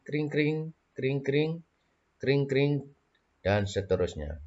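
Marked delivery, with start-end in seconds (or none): 0.0-2.7 high ringing bell sound; bicycle bell. Shown as a demonstration. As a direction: ring out high like a bike bell. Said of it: "Tirukan suara bel sepeda".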